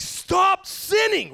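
high, drawn-out mock shout; mock pounding preacher. Preacher pounding the congregation about sin, exaggerated shout. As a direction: bellow it like a hammed-up fire-and-brimstone preacher. I'm not up here pounding you.

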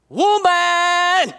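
high, drawn-out mock holler; calling out woman. Preacher exaggerating Jesus addressing his mother, mock holler. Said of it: "where you go".

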